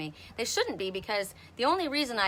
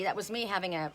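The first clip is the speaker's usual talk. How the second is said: ordinary talk, nothing marked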